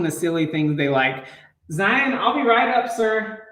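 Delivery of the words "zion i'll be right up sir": raised, higher call up the stairs; calling out to son. Real, not acted. Calling upstairs to his son.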